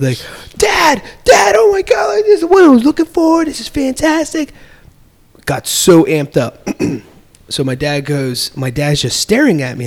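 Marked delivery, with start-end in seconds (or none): 0.6-4.5 put-on high, loud kid's excited shout; thrilled child begging his dad. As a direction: burst out like an eight-year-old who found his game. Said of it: "getting extremely excited".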